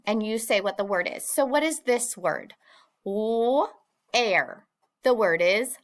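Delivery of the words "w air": "W, air" split with a pause after the first sound; first sound, then ending. Shown as a demonstration. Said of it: "first sound and then the ending".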